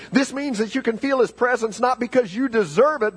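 high, urgent pulpit proclamation; fervent sermon climax; impassioned preaching. Real, not acted. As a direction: lift the pitch and drive each phrase home.

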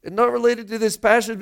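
ordinary talk, nothing marked

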